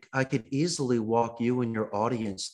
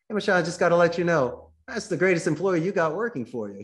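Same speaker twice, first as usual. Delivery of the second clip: enthusiastic praise; 6 semitones higher, 3 dB louder than usual. Shown as a demonstration. Caller praises employee to boss.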